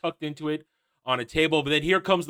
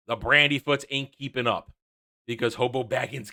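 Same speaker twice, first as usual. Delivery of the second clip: usual speaking voice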